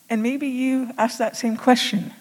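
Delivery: usual speaking voice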